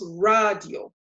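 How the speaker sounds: "radio" as RAH-dyo, two syllables; slow Spanish "radio" with stressed RAH; Spanish pronunciation. Shown as a demonstration. Teacher sums up for learners, "radio" said RAH-dyo. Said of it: "So this becomes".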